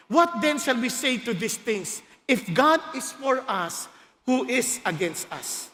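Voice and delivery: slow, low reading that fades; slow Bible reading to the congregation; solemn scripture reading. Acted. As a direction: read the verse with reverence.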